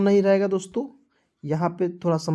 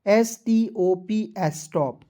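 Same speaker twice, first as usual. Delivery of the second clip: slow spelled drill; much slower than usual. Shown as a demonstration. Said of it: "spelling drill".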